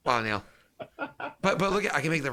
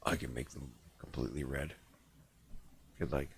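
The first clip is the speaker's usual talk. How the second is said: very low, soft voice; low, hushed offer; 12 semitones lower, 12 dB quieter than usual. Pretend. Host offering red lights to co-host, low hushed murmur. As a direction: drop deep and quiet, conspiratorial.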